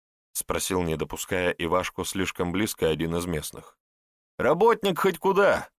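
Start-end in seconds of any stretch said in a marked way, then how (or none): 4.4-5.7 put-on puffed-up young man's boast, pitched high; proud boast. A narrator's reading. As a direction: boast of yourself in a much higher voice. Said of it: "приосанившись, заявил парень". (бодрая хвастливая заявка, высоким голосом)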